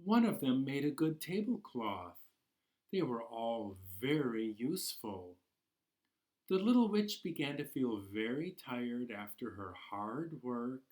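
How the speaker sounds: gentle storybook narration, unhurried; reading to children. Acted. Teacher reading Ghosts in the House to class, storybook voice. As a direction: draw the little ones into the tale. Reads picture book to children.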